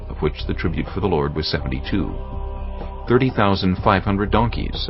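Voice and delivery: ordinary talk, nothing marked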